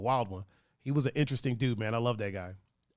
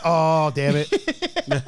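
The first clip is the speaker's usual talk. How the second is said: mock regret; 4 dB louder, much slower than usual.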